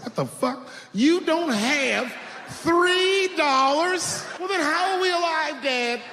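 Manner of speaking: high-pitched, drawn-out mock outrage; incredulous child. Son confronting dad over money, high incredulous wail. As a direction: wail it in disbelief at your father.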